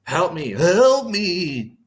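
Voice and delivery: high, slow mock wail; mocking cry for help. Narrator mocks Fortunato's pleas from the tomb, hammed-up wail. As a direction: ham up the victim's pleas to mock him. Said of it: "repeat back to him and make fun".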